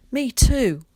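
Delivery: put-on loud high child's cry; cried out. A narrator's reading. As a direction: call it out eagerly. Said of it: "cried a voice behind him".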